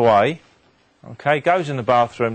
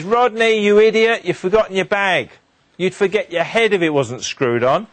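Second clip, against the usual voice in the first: high, raised exasperated telling-off; mock-angry mum calling out after her child; acted scolding mum; 6 semitones higher, 3 dB louder than usual. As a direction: scold a forgetful son, pitched well up. Mum scolds son in front of passers-by.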